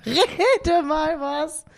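slow, high teasing echo. Pretend. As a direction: drag it out to tease. Echoes the prompt teasingly.

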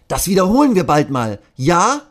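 a friendly goodbye promise; cheerful policeman. Acted. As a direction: policeman saying goodbye to the classes. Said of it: "sagt Patrick".

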